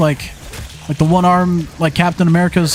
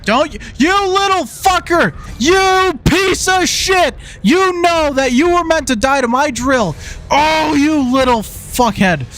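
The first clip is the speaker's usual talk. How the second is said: screaming high-pitched rage at a zombie; furious high yelling; 8 semitones higher than usual. Real, not acted. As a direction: shriek the insults, furious and high. Raging at zombies in game.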